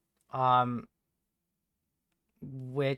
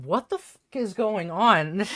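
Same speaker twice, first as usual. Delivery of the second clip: startled; 7 semitones higher, 6 dB louder, slower than usual. Retold or imitated. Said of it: "I was like".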